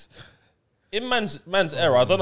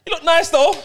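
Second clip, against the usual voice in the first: high smooth chat-up voice; 6 dB louder than usual. Retold or imitated. Acted chat-up line to girl.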